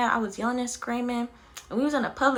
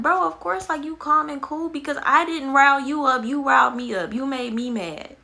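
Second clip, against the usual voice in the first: loud, heated comeback, settling at the end; mad retort; 2 semitones higher, 5 dB louder than usual. Retold or imitated. Retold reply to the man who riled her up, mad and loud. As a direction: throw the blame back forcefully. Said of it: "that shit made me mad".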